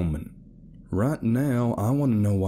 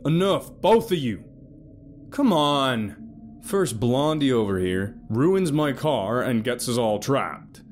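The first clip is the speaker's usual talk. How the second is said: louder, heated accusing; angry rant; 7 semitones higher, 3 dB louder than usual. A narrator's reading. Alex ranting against Carol to Felton, angry.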